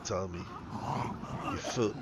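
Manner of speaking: normal talking voice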